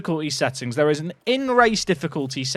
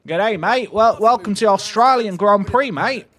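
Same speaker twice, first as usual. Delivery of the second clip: hammed-up Australian accent, lively and raised; 4 semitones higher, 4 dB louder than usual. Streamer welcomes chat to the Grand Prix, in an attempted Australian accent. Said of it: "in an Australian accent".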